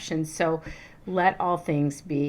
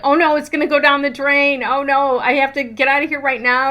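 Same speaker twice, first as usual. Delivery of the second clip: a raised, hurried mock panic; panicked cry of oh no, hammed up; retold panic; 7 semitones higher, 8 dB louder than usual. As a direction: replay the rush of losing the ring, half-laughing. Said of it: "I am, like, freaking out".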